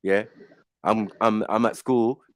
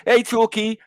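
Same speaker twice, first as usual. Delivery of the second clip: higher, mocking taunt; 8 semitones higher than usual. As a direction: sneer it like a playground bully.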